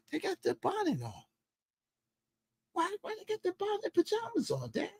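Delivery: high, quiet questioning son voice. Retold or imitated. Son questioning his dad about the women, high soft imitation. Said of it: "my son bringing the mind to".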